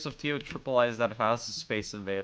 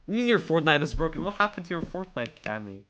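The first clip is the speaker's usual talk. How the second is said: ordinary talk, nothing marked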